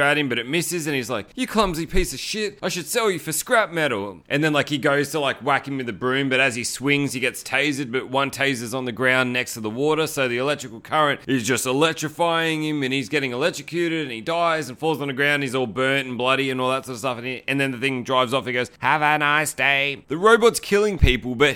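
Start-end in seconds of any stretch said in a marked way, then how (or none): 1.3-4.2 higher-pitched put-on scolding; a touch of exasperated insult; mock annoyance. Janitor telling off the clumsy robot, mock annoyed. 18.8-20.0 slow, measured robot sign-off. Retold or imitated. Robot driving off from its victim, slow imitated robot voice. Voicing robot's parting line.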